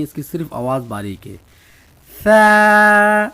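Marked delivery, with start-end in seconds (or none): 2.2-3.3 ثا loud and high, tongue lightly between the teeth, air let out; a loud, light ثا with the tongue tip out; thin Tha. Shown as a demonstration. Teacher shows students the letter ثا, loud with the tongue between the teeth. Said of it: "زبان باہر دانتوں کے بیچ میں رکھ کے".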